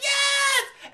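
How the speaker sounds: high, loud joyful scream; ecstatic shout. Retold or imitated. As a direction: let out a fan's shriek of joy. Crowd going wild at encore.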